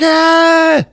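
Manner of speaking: high, loud imitated scream; acted shriek of alarm; mock terrified scream. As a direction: scream in put-on horror. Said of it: "He's like".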